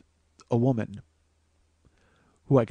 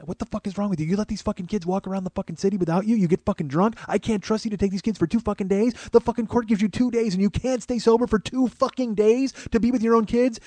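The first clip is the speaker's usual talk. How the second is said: high-pitched angry tirade, building higher; mock screaming mom; 8 semitones higher than usual. Mom berating dad over the kids, mock screaming. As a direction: imitate a mother tearing into her ex without full shouting. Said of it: "she just starts screaming at my dad".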